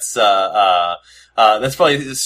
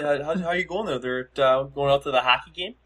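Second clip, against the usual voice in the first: higher, softer put-on Canadian hockey-fan voice; mock Canadian accent; 4 semitones higher, 5 dB quieter than usual. Host sending up his own hockey-night talk, mock Canadian. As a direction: ham up a light, high Canadian accent. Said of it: "I sound like I'm".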